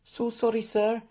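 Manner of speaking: very high-pitched meek apology; embarrassed maid. A narrator's reading. Maid apologises before hastily retreating, embarrassed. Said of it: "blushing and bowing her head".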